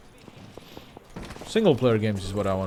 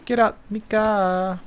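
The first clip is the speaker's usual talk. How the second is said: higher, slow hammy meme voice; 10 semitones higher, slower than usual. Pretend. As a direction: drag out the meme line. Acting the car meme.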